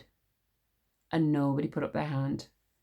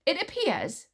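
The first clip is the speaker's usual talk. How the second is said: cat-bite tone; 9 semitones higher than usual. A narrator's reading. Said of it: "she said in a tone like a cat bite".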